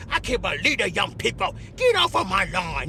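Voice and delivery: put-on low grumpy old man yelling; grumpy boomer. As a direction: play a cranky old man shooing kids off the lawn. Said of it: "I was like a chicken boomer".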